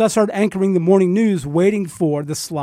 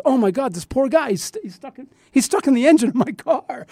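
distressed; 6 semitones higher than usual. Retold or imitated. Dog stuck in the car.

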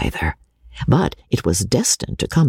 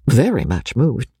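ordinary delivery

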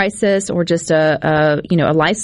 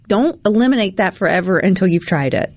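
usual speaking voice

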